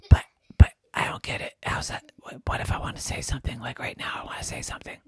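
hoarse whisper with no voice; lost voice. Acted. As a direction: try to talk but only air comes out. Jerry voiceless throughout.

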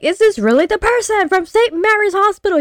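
very high, disbelieving imitated question; mock incredulous. Doctor questions a caller's identity, high mock disbelief. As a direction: mimic someone incredulous, pitch way up.

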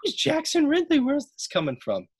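high-pitched mock bewilderment; baffled disbelief. Wrestlers watching from the background, imitated in baffled disbelief. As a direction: imitate friends thrown by a strange name. Friends react baffled to unknown name.

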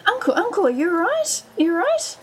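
high, fretting questions; acted concern. Niece to her fallen uncle, acted concern.

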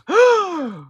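sharp high mock gasp; shocked gasp. Imitating mother hearing son swear on phone, shocked gasp. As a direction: imitate a horrified intake of breath. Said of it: "I just hear Kim go".

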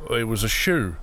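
understated attempt at confidence; mustered confidence. A narrator's reading. Peter confesses to the old woman, mildly confident. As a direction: try to sound sure, without much force. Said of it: "with as much confidence as he could marshal".

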